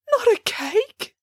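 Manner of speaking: high, drawn-out, rising question; dismayed exclamation. A narrator's reading. Bramwell expecting cake as his prize, high dismay. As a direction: squeak it out in disbelief. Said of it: "exclaimed Bramwell".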